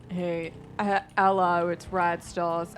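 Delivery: put-on loud, slow Australian accent greeting. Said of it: "I think he's Australian or something".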